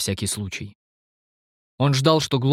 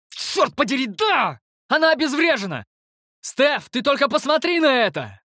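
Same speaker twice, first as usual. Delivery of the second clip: much higher, elated cheering; jubilant. Acted. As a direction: shout for joy at the win. Triumphant outburst after victory.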